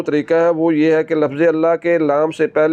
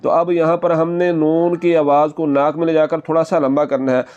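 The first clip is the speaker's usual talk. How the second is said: usual speaking voice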